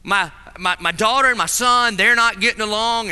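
ordinary talk, nothing marked

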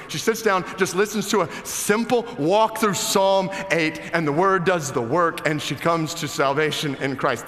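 high, loud exhortation; forceful exhortation. Acted. Pastor urges the church to devote itself to prayer, forceful. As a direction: urge them with all your force.

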